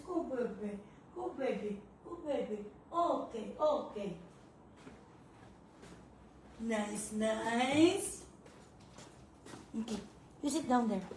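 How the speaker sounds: hushed cooing. Real, not acted. A mother softly soothing her baby. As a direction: murmur gently to the little one.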